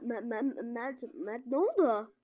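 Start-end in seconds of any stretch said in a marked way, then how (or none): none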